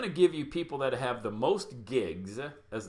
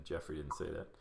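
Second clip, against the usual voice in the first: normal talking voice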